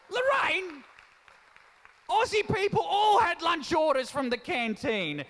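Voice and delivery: comic shout at the audience member; playful shout. Real, not acted. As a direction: bellow it at Lorraine in mock outrage. Scolding audience member Lorraine.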